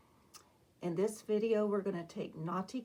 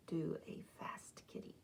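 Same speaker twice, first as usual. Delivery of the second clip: much softer, breathy whisper; 3 semitones lower, 10 dB quieter than usual. Acted. Teacher whispering the next idea to the kids. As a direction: whisper the plan like a secret.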